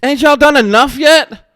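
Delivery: loud, high, slow outraged protest; exasperated yell. Retold or imitated. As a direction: throw it back at them, fed up. Outraged retort to the cops.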